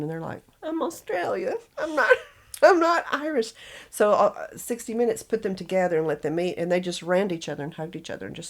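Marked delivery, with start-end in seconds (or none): 0.6-3.5 high-pitched retold objection; mock protest. Accented women answering strangers back home, mock protest. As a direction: imitate an exasperated denial, pitched up and breaking into a laugh.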